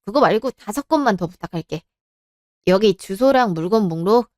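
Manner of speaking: usual speaking voice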